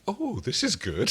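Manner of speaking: high, slow, soft and wondering; delighted alien. Retold or imitated. Rigel in Crichton's body peeing, high delighted. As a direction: savor it like a treat. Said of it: "Rigel is like".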